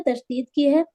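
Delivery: normal talking voice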